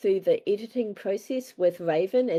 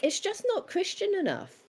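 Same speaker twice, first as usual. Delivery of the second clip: quoting friend; 12 semitones higher than usual. Retold or imitated. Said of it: "eventually she was like".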